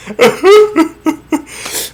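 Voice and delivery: real burst of laughter; high, falling laugh. Real, not acted. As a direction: crack up at your own joke. Teller cracks up.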